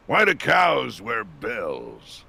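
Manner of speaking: very slow, drawn-out dad joke; slow joke delivery. Acted. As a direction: stretch every word of the punchline. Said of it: "Asa telling a bad joke".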